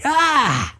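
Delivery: high "ah" of pain; acted pain cry. A narrator's reading. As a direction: imitate a sudden high yelp. Handcuffed man reacts to tight cuffs.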